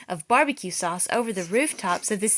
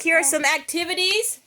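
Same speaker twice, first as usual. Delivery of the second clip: ordinary delivery, nothing marked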